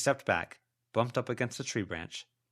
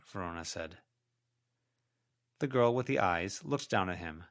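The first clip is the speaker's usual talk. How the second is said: usual speaking voice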